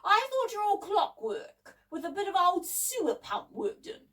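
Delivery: high-pitched shouted challenge. A narrator's reading. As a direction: keep shouting, voice well up. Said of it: "shouted David".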